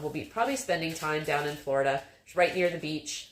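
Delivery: ordinary talk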